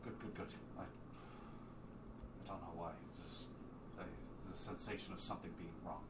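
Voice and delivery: low, very soft, halting voice; uneasy murmur. Acted. Sphinx confiding that something feels wrong, hushed unease. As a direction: trail off quietly into private worry.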